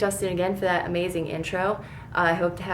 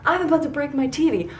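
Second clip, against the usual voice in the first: retold yell; 9 semitones higher than usual. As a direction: spit it out at the screen. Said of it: "I'm screaming at her".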